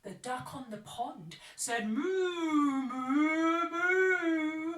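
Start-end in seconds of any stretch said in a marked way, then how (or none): 1.9-4.7 loud lowing moo. Acted. Duck moos.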